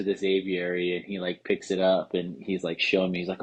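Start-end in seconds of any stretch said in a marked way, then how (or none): none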